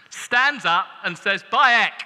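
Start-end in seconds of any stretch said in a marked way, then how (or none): 1.5-1.9 loud, blunt northern cry of behold; mock northern prophet bursting out with behold. Northern prophet breaking the holy silence, loud northern call. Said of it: "'Cause he had a northern".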